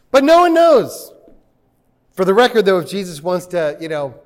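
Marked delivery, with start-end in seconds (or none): 0.1-1.1 NO much higher and louder; emphatic declaration. Real, not acted. Pastor insisting nobody knows, loud and high. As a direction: punch it out to the congregation. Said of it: "punchline emphasis".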